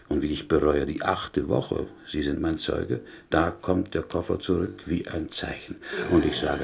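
normal talking voice

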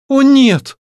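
very high-pitched, wounded protest; tearful. A narrator's reading. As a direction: daughter crying out to her father in denial. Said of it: "в слезах произнесла Варя". (в слезах, протестуя)

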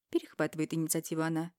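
ordinary delivery

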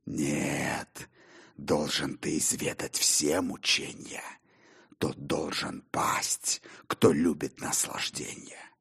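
low, soft, ominous angel of evil. Acted. Angel of evil condemns Faustus, low and ominous. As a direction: pronounce doom in a low, hushed voice.

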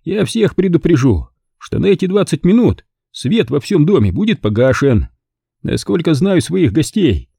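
a confident host relishing his plan; Stanton's voice. A narrator's reading.

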